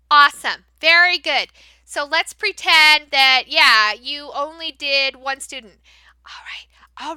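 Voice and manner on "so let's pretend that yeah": normal talking voice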